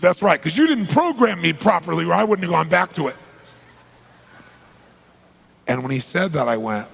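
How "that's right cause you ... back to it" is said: blaming. Retold or imitated. Ex-client blames speaker, insistent.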